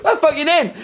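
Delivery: very high retold road rage; mock outraged challenge. Retelling the big bald man coming round, mock outrage. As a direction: confront them in strained mock outrage.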